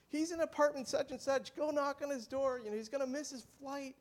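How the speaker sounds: high-pitched mock calling out; imitated yelling. Man asking the building to fetch Jacob, imitated yell. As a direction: call up to the neighbours, pitched high, not full volume. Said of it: "this guy was just yelling".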